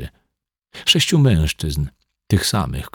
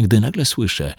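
plain reading voice

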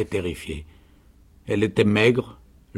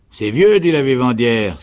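high, loud exclamation; soldierly canteen woman; 3 semitones higher, 8 dB louder than usual. A narrator's reading. Canteen woman exclaims about baby's age. (exclamation forte et aiguë de vivandière)

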